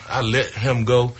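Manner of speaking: normal talking voice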